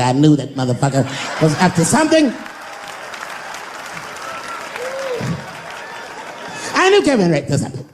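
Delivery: an I-told-you-so sneer rising high; Cat Williams. Retold or imitated. A rival comic gloating over Kevin's downfall, smug. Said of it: "He'd be like".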